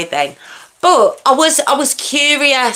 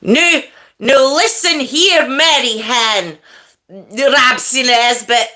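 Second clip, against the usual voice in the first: hammed-up Scottish accent; Rab C Nesbitt; slower than usual. Shown as a demonstration. Livestreamer attempting their only Scottish impression, hammy accent. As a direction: put on a bad, drawn-out Scottish accent. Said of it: "I can only do it from Rab C Nesbitt".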